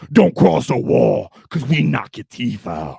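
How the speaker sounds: slow, loud ork menace; closing the stream with an orc threat. Acted. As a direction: growl it out like a warboss warning enemies.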